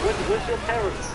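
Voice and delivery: high-pitched mock Cambodian accent; imitated Cambodian accent. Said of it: "a much more thicker fucking accent".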